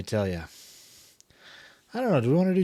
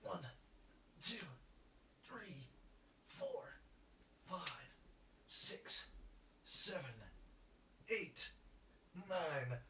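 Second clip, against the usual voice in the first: whispered count through effort; breathless counting; 6 semitones higher, 25 dB quieter, slower than usual. Real, not acted. Gamer doing push-ups, breathless whispered counting. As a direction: count under your breath between reps.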